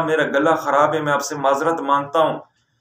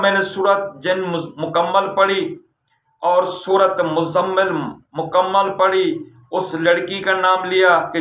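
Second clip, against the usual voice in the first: usual speaking voice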